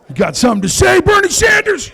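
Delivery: loud challenge rising high on the name; mock confrontation. Comic confronting the old man in the parking lot, loud and high.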